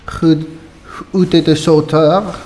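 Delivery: put-on heavily French-accented game character. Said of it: "These people are super French".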